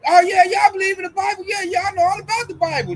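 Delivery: high, eager mock brag; mock boasting. Imitating the coworker claiming to know the Bible, high mocking voice.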